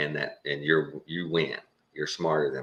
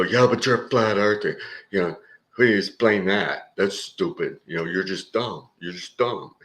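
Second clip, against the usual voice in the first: mocking critic; 4 semitones higher than usual. YouTube critics taunting a flat earther, mock sneering. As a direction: lightly voice a scoffing troll. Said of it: "all they can do is, is go".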